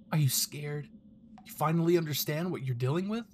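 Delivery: a mocking captor, lightly voiced, teasing the hostage; a hint of a cruel captor; taunting villain. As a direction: sneer at the scared hostage.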